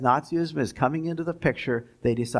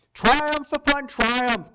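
higher, slow, savoring each word; gloating; 11 semitones higher, 3 dB louder, much slower than usual. Retold or imitated. Lecturer quoting Goebbels on the election win, gloating. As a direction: let the triumph ring out, relishing it. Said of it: "gloated Goebbels".

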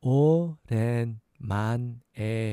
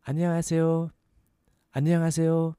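안녕하세요 joined up, held high and even; a little faster; 5 semitones higher, much faster than usual. Shown as a demonstration. Teacher repeating hello for learners, a little faster. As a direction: run 안녕하세요 together on a raised pitch. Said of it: "A little faster".

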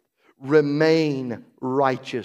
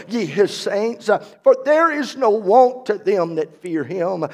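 preaching at full force; 7 semitones higher, 10 dB louder than usual. Acted. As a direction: lift the psalm up like a shout.

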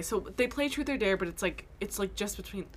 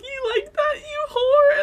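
much higher and louder, hammed-up yell; mock fight taunt; 9 dB louder than usual. Quoting Tessa mid-punch, high hammed-up yell. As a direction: yell it like a brawler, tongue in cheek.

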